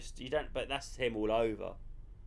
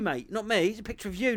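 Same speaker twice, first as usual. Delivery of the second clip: ordinary talk, nothing marked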